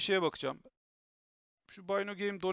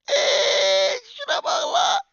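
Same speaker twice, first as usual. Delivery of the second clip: excited squeal; 12 dB louder, much slower than usual. Real, not acted. Thrilled by camera focus.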